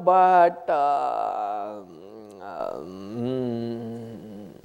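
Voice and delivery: acted hesitant backing out, trailing into fillers; wavering lack of resolve. Disciple to Swamiji after signing up, acted wavering. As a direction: waver and stall, dragging out the ums. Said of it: "that is itare".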